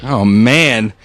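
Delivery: usual speaking voice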